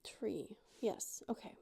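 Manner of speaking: whisper. Real, not acted.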